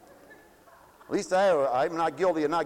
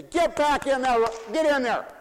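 put-on higher, louder barked order to a child; stern command; 8 semitones higher, 5 dB louder than usual. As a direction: call the kid straight back off the street. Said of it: "Just say".

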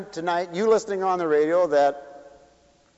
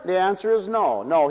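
normal talking voice

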